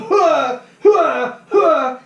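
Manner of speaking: high, loud mock retching; mimicked dry heaving. Wife turning from the blood, mimicked dry heaving. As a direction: imitate repeated dry heaves. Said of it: "starts dry heaving".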